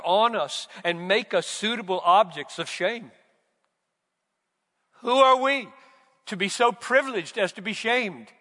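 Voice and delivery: loud, high preaching cry; full-voiced pulpit amazement; fervent preaching.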